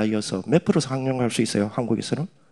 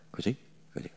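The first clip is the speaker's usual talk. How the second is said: ordinary talk, nothing marked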